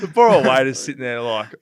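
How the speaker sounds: normal talking voice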